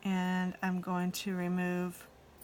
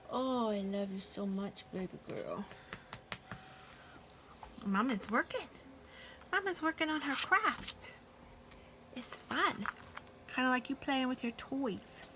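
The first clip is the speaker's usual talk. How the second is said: sweet nothings; 5 semitones higher, 4 dB quieter than usual. Real, not acted. Owner coos to her dog.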